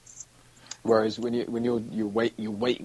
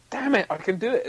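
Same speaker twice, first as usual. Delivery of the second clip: high, louder burst of triumph; triumphant realisation; 10 semitones higher, 6 dB louder than usual. Retold or imitated. Impressionist recalling finally getting a voice, triumphant. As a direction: exclaim it, pitch and volume up.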